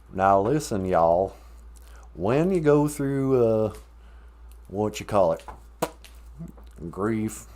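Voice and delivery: light mock Southern drawl, slowed on "when you go through". Joking through grief talk with her partner, in a light mock Southern drawl. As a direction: play at a Southern accent, half-hearted. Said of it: "say it with a southern drawl".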